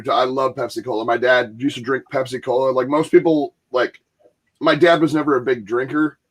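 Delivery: ordinary delivery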